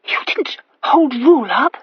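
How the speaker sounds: breathless whispered gasp; young wife aghast at the news; horrified gasp. A narrator's reading. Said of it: "she gasped".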